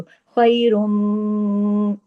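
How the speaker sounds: खैरुम with the meem hummed long through the nose; long ghunna. Shown as a demonstration. Teacher showing students the full nasal meem. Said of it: "आवाज देर तक नाक में छुपेगी".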